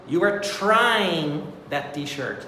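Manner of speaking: slow, careful classroom example. Shown as a demonstration. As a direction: spell it out slowly for learners. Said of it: "example sentence".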